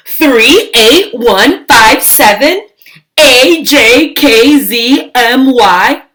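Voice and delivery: loud, sing-song jumble of numbers; mock teaching. Lily teaching baby Julius wrong letters, loud and sing-song. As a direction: chant nonsense at the baby mischievously.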